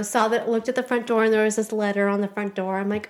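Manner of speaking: usual speaking voice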